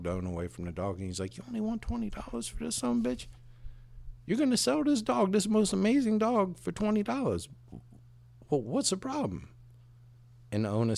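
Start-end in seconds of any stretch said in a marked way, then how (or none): 1.4-9.5 higher and fast, disbelieving; can't believe the price; incredulous. Acted. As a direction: buyer stunned by the cheap price, higher and fast. Incredulous buyer in joke.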